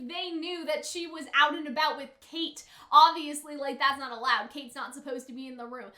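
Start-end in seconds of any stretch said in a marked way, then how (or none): none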